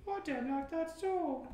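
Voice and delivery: very high-pitched, vaguely British put-on voice; bad fake British accent. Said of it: "vaguely bad fake British accent".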